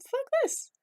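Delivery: high exasperated cry; mother rejecting an ugly baby. Retold or imitated. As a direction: toss it off in disgust. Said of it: "she's like".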